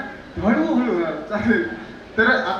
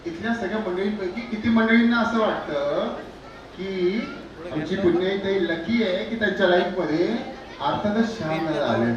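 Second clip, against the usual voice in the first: usual speaking voice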